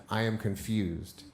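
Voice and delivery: ordinary talk, nothing marked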